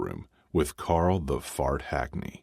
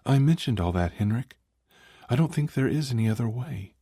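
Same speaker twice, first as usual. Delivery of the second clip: female voice; 8 semitones higher than usual. Acted. Tiffany voiced, tired.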